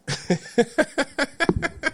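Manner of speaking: high, airy burst of laughter. Real, not acted. Vlogger laughing at needing a wok, high laugh. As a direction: laugh at yourself.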